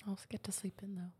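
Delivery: whispered, teasing aside. Real, not acted. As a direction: whisper it like a cheeky secret. Teasing aside while exhausted.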